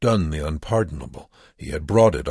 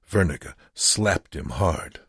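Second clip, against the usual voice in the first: usual speaking voice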